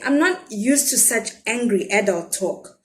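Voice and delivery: ordinary delivery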